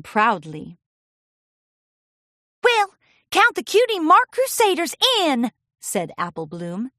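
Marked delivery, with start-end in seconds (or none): 2.6-5.5 put-on high, loud kid voice; filly voice. Apple Bloom answering Pinkie, high eager voice. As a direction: sign up eagerly.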